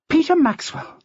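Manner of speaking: breathless snap. A narrator's reading. Jackie, breathless from the slope, snapping at Maxwell. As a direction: snap his name out, winded and furious. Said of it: "she snapped as best she could when so out of breath".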